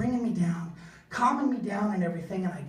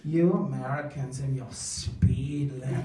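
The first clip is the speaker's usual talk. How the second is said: mock German uncle; 3 semitones higher, 6 dB louder, slower than usual. German in-law mocking American speed limits, slow singsong. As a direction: tease like a smug European in-law.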